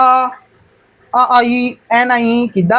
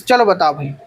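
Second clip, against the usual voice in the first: usual speaking voice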